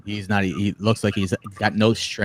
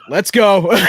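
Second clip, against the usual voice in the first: a big, eager "let's go", much higher and louder; excited shout; 10 semitones higher, 7 dB louder than usual. Retold or imitated. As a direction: jump at the chance to help. Eager reply to buy coffee.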